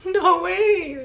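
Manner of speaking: high, loud cry of disbelief; stunned disbelief. Retold or imitated. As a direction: burst out as if you can't believe it. Stunned by father's love.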